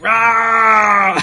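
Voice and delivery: loud, high mock roar; super mad roar. Imitating the toy's screaming face, a mad roar. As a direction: roar like a furious action figure. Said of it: "He's super mad".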